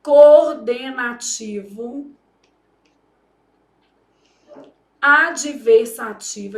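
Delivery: each syllable drawn out slowly. Shown as a demonstration. Teacher spelling out the grammar term slowly. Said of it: "isso aqui é um conector".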